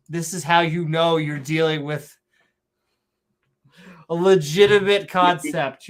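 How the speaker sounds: ordinary talk, nothing marked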